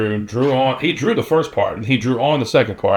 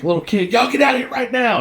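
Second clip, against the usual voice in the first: retold outburst, pitched way up; angry ejection; 12 semitones higher than usual. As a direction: imitate an irate boss ordering kids out. Angry owner throws kid out, retold.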